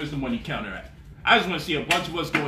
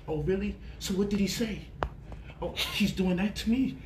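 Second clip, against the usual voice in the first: fake concern; 5 semitones higher, 3 dB quieter than usual. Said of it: "I do my best to act a little concerned".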